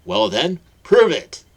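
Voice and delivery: very high, loud triumphant shout; loud challenge. Acted. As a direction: dare her to prove it. Hagrid challenges Petunia.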